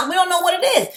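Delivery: high, brisk emphatic aside. Real, not acted. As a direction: toss it off quickly, pitch up. Repeating example, raised pitch.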